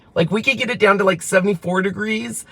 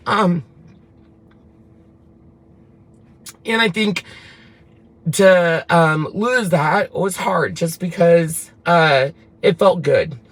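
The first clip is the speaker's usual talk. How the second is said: voice catching with grief; slower than usual. Real, not acted. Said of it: "I'm sorry, I'm getting emotional".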